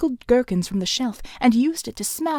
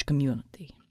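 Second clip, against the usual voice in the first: menacing whisper; 8 semitones lower, 6 dB quieter than usual. A narrator's reading. Villain drops to whisper.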